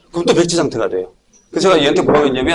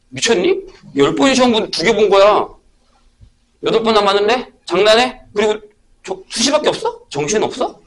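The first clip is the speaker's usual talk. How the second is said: higher, mock-outraged teacher telling off a student; incredulous scolding; 5 semitones higher than usual. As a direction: scoff at the student for giving up so early. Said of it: "이렇게 얘기한단 말이에요". (포기하려는 학생을 황당해하며 높은 목소리로 꾸짖듯)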